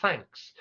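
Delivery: Thanks with a clean TH; clear TH. Shown as a demonstration. Teacher repeating the received pronunciation thanks. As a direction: sound the TH in Thanks. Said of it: "T H sound being very well pronounced".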